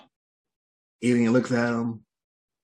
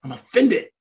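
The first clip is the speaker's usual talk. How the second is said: mock offended; 6 semitones higher, 3 dB louder than usual. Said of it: "He's getting angry".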